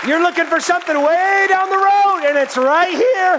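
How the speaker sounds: high, loud preacher's shout; full-force preaching. Real, not acted. Preacher urges friends that it's right in front, shouting. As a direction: cry it out to the congregation, stretching key words.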